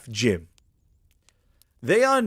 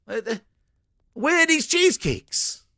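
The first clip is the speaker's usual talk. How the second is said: exasperated customer; 11 semitones higher, 4 dB louder than usual. Retold or imitated. Customer demands the cheesecakes.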